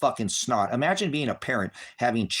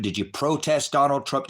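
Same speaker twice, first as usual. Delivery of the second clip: ordinary delivery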